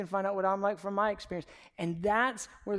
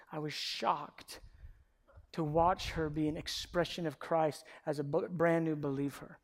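ordinary talk, nothing marked

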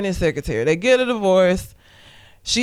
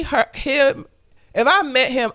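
ordinary delivery

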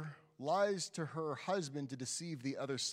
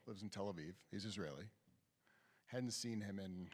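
ordinary delivery

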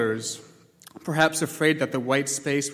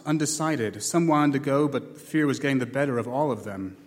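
ordinary delivery, nothing marked

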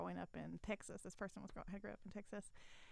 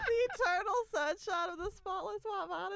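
higher, louder incredulous laughing echo; laughing repeat; 12 semitones higher, 12 dB louder than usual. Retold or imitated. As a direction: repeat it through laughter in disbelief. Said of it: "Is that what you just said?".

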